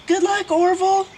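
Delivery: high, loud shout. A narrator's reading. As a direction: shout encouragement. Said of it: "shouted Raz".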